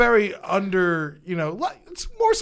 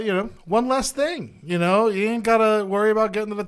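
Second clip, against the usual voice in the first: usual speaking voice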